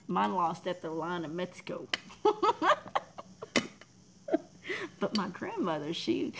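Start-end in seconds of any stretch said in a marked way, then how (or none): 1.9-3.8 loud high-pitched laugh; squealing laugh. Real, not acted. Laughing at own joke.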